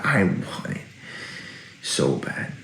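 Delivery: trailing into a whisper; whispered longing. Real, not acted. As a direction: breathe the words out with longing. Repeats the longing, drops to whisper.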